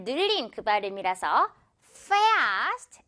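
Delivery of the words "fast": "fast" with the A opened wide and stretched long; long vowel. Shown as a demonstration. Teacher shows students the long open A. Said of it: "크고 길게 발음해".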